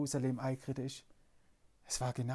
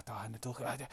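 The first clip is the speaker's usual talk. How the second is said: whisper; 6 semitones lower, 12 dB quieter than usual. Acted. Sneaking after the donkey.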